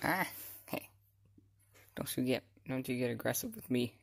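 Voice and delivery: ordinary talk, nothing marked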